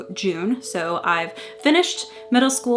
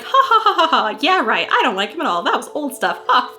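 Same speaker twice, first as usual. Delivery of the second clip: mock laugh; 5 semitones higher, 4 dB louder than usual. Quoting fake-casual diary laugh.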